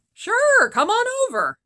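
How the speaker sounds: high, loud eager crone squawk; high cackling old woman. Acted. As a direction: squawk a cheery welcome.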